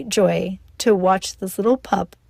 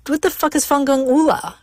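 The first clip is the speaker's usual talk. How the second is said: baffled outrage; 5 semitones higher than usual. Retold or imitated. Asking mom about her gibberish curse, baffled. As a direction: react like the word makes no sense. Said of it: "And I said".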